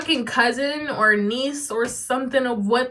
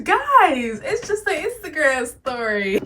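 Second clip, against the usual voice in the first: exasperated; 4 semitones higher, slower than usual. Real, not acted. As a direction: plead in disbelief. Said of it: "exasperated address to viewers".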